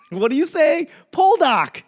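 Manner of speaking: high, loud Boston accent mock; a rowdy Boston guy calling out, hammed up. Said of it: "that Boston accent shows up".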